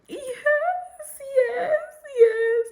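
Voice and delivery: high, tearful yes through sobs; crying yes. Retold or imitated. Bride accepting the proposal while crying, tearful high yes. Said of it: "'cause I'm crying".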